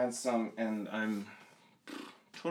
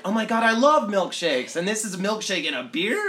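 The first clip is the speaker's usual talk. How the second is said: high, loud and gushing; excited gushing; 9 semitones higher, 7 dB louder than usual. Retold or imitated. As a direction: gush like a thrilled fan. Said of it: "people are like".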